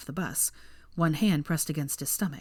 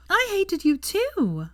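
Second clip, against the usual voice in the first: put-on high, slow, delighted drunken cry of agreement; excited exclaim; 13 semitones higher, much slower than usual. A narrator's reading. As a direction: gush it out, thrilled to agree. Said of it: "Hermione exclaimed excitedly".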